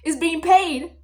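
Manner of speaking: loud, fast gloating tease; teasing the boy about his own snake cuffs, loud and fast; teasing warning. Retold or imitated. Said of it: "I was like".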